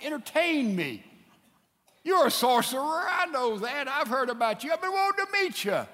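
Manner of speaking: high, insistent demand to be entertained; demanding Herod. Retold or imitated. Herod orders Jesus to entertain him, mock-eager demand. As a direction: act an eager ruler, pitched well up.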